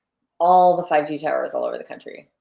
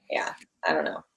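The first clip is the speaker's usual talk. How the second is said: whispered and dropped away; unvoiced trailing whisper; 13 semitones lower, 8 dB quieter than usual. Real, not acted. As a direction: breathe it out under the breath. Trailing off after a rant.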